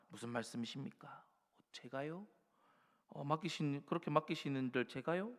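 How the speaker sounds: hushed mock disbelief; doubtful protest. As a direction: play the hesitant servant, softly. Congregation as Gideon doubting.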